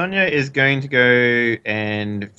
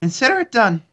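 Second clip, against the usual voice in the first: high-pitched woman's voice; put-on woman voice; 10 semitones higher than usual. [name] promises Katya a favour, put-on woman voice. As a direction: accept the task in a raised woman voice. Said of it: "I need to get into my woman accent".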